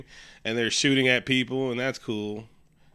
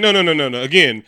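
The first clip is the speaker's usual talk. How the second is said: loud, higher, slowed-down mock announcement; emphatic insistence; 5 semitones higher, 9 dB louder than usual. Guard repeating his boast into the mic, insistent. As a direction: brush off doubts with big pompous emphasis.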